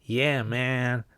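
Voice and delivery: stretched-out, exaggerated American accent; mock American accent. Teacher defends himself to viewers in mock American accent. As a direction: ham up a drawling American accent. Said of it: "What do you mean the worst American accent?".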